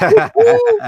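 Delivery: loud, high celebratory whoop; excited whoop. Real, not acted. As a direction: cheer him on, loud and high. Hyping up a guest.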